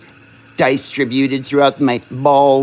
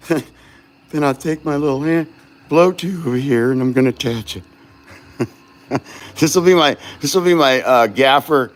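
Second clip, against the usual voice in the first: ordinary talk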